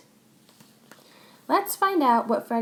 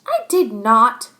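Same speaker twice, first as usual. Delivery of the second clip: louder, slower denial; defensive yell; 9 dB louder, slower than usual. Acted. Max yells back at Chloe, louder. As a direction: bark back at her. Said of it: "yelled Max".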